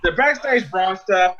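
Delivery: ordinary delivery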